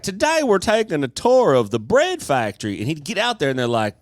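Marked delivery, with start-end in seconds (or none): 0.0-2.8 high, loud, upbeat tour-host voice; a cheery public-TV host opening the show; imitating the TV host announcing a tour to viewers, high and bright; Huel Hauser impression. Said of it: "Huel Hauser".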